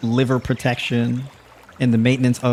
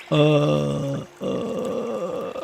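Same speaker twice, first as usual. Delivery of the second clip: struggling filler; 3 semitones higher, 5 dB quieter than usual. Shown as a demonstration. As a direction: drag out "uh" like a toasted brain. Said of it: "That's your brain doing reps".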